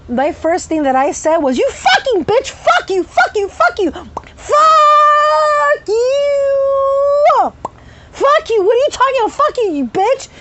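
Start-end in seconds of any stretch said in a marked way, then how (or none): 1.6-10.3 high, screamed swearing; furious cursing outburst. Retold or imitated. Patient's Tourette's going off on psychiatrist, furious screaming. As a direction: scream abuse at the top of your range.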